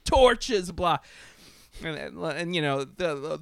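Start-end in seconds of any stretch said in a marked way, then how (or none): 0.0-1.0 much higher and louder, a blustering rant; mock outraged pundit. Mocking podcast assholes demanding the Oscar back, in a high blustery rant. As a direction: lampoon a pitchfork-waving blowhard. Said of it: "assholes who are just gonna be like".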